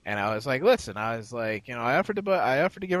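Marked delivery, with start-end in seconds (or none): 0.6-1.0 higher, louder call to listen. Retold or imitated. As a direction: grab his attention. Retold plea to biker.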